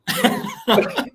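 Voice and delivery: very high helpless laugh; squealing fit of laughter; burst of laughter. Real, not acted. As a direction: lose it again, laughing.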